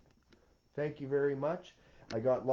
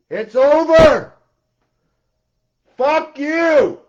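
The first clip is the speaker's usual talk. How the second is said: much higher, much louder, slowed furious shouting; angry tirade; 8 semitones higher, 11 dB louder than usual. Real, not acted. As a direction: tear into them at full volume. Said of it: "Sorry, I have to get angry".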